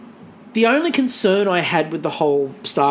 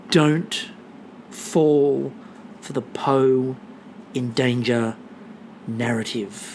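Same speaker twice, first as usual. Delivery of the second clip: each word spaced, fading quieter; slow solemn warning; 6 semitones lower, 5 dB quieter, much slower than usual. Shown as a demonstration. Agony aunt warning the audience, slow and spaced. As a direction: deliver it like grave advice. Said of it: "a reminder".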